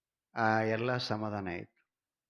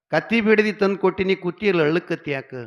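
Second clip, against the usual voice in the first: put-on higher, louder plea to a crying boy; exasperated pleading; 7 semitones higher, 10 dB louder, faster than usual. Father pleading loudly with his crying son. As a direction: demand why he still cries, voice raised.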